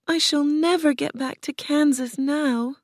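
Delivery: lightly voiced girl, sad and homesick; sadly. A narrator's reading. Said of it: "she said sadly".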